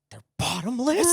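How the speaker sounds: high, slow mock amazement; Gracie. Retelling a friend learning of refills, mock amazement. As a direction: imitate a gasping, wide-open astonishment.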